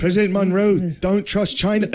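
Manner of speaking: put-on high, loud accent drifting British; hammed-up Irish accent sliding toward British; mock Irish accent slipping British. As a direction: go for Irish but slide into British. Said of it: "That was British a little".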